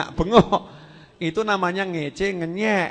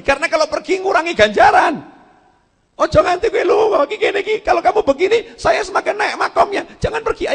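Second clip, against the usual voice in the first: much higher and louder, begging them to stay; impassioned pleading shout; 13 semitones higher, 8 dB louder than usual. Retold or imitated. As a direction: call out hard so the maggots won't leave. Nabi Ayub memohon belatung kembali. (seruan memohon, jauh lebih tinggi dan keras)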